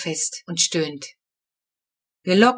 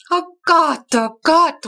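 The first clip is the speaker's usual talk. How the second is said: high, loud, shocked oh-God; a mother wailing at a disaster; horrified cry; 9 semitones higher, 5 dB louder, slower than usual. A narrator's reading. Mama seeing Titus fall into the pond, crying out horrified. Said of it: "ruft Mama".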